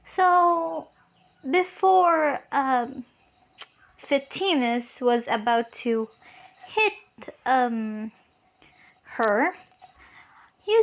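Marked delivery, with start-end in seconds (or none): none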